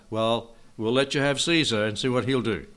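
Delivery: ordinary talk, nothing marked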